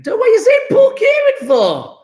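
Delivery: high, loud mock-outraged shout; indignant protest. Real, not acted. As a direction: protest at the top of your voice. Mock protest at viewer's comment.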